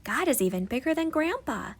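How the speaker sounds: ordinary delivery, nothing marked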